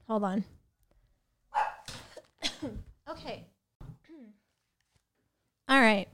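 usual speaking voice

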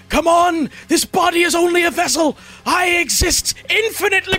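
high loud ranting fanatic; raving cultist. Acted. Cultist yelling from inside the cave, high loud rant. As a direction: shout your immortality at them.

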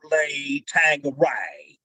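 put-on slow drawn-out Southern accent; slow drawn-out Southern accent, copying Alan Rickman. Imitating Alan Rickman's Southern accent on set, slow. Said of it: "speaking in a Southern accent".

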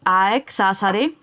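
names repeated slowly; careful slow rereading of team names. Shown as a demonstration. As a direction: spell the names out slowly for him. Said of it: "για ξαναπες τη σαεκ".

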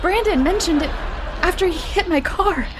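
panicked. Acted. Michael panicking, voiced higher.

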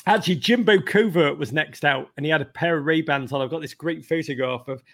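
normal talking voice

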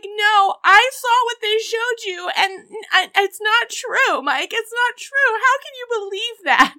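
high, loud wail of innocence; whiny, wounded protest; mock pleading wife. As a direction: plead with him in an overdone tearful voice. Wife denies it to husband, retold.

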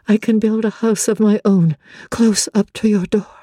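plain reading voice

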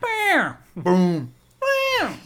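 loud comic-book punch noises, first one high; Batman sound effects. Retold or imitated. Cohost riffing on cops getting hit, yelled bam boom. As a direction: shout the fight sounds like an old Batman show. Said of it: "That's the Batman sound effects".

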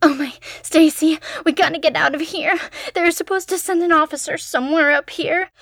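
put-on high, frantic frightened girl; panicked young woman. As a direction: rush it out in fear. Panicked girl finds her friend.

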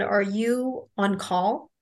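usual speaking voice

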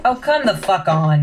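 loud, drawn-out exasperation; slow, loud, exasperated groan of words; frustrated outburst. Acted. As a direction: curse loudly in frustration, slowly. Target missing from the room.

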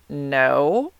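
drawn-out, rising, unconvincing denial; guilty-sounding no. Retold or imitated. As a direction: imitate your own guilty lie, voice sliding upward. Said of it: "That sounds so guilty".

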